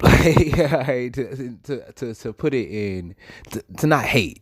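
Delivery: high, halting words through laughter; laughing through words. Real, not acted. Storyteller laughing as he tries not to hate, laughing through words. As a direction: stumble for tactful wording while laughing.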